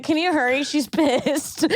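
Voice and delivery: high, mock-panicked urging; lightly hammed-up desperation; urgent plea. Texting sister's boyfriend to hurry, high urgent plea.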